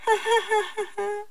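high put-on giggle; jackal's laugh. A narrator's reading. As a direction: laugh in a rapid high titter. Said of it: "rit le petit animal". (petit rire aigu de chacal)

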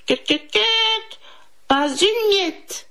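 caquètement aigu de poules; poules qui caquettent. A narrator's reading. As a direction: caqueter haut puis refuser en poule. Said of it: "ajoutèrent les quatre poules". (voix aiguë de poule caquetante)

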